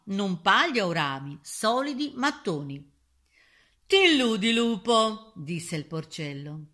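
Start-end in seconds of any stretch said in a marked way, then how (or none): 3.9-5.3 loud, raised, taunting retort; defiant piglet. A narrator's reading. Clever pig mocks the wolf from his house, defiant. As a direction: throw it boldly at the wolf.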